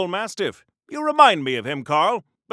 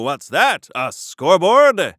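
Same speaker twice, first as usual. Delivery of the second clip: ordinary talk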